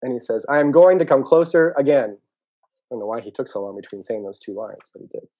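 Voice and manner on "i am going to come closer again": loud, high announcement shout; calling out. Acted. Turtle telling the mole of moving closer, calling out. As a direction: holler the plan across a distance.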